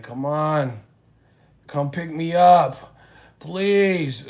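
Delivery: loud, high begging into the phone; mock a loud desperate plea over the phone; loud pleading. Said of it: "talking hella loud".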